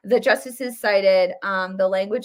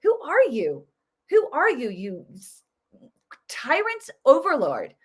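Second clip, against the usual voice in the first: higher, indignant retort; imagined retort to the president, higher and indignant; mock confrontation; 4 semitones higher than usual. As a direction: challenge him as if he stood there. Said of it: "I'd be like".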